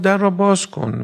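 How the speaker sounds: a preacher voicing Umar's shouted threat; angry demand. A narrator's reading. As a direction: bark the order at the door. Umar threatens at the door.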